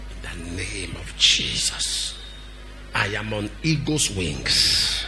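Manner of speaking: slow, low, softened declaration; deep-pitched, drawn-out, untroubled prayer; calm confidence. Acted. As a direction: pray it unhurried, completely at ease. Acts out relaxed, confident prayer.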